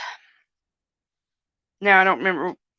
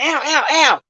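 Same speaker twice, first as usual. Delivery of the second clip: pained ows; 9 semitones higher, 4 dB louder than usual. Real, not acted. Real pain, repeated cries.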